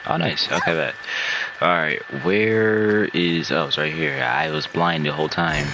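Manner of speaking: ordinary talk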